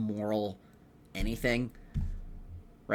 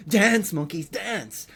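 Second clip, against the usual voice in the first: mock taskmaster command; 6 semitones higher, much slower than usual. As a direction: bark it like a mock circus boss ordering monkeys. Mocking a host ordering contestants.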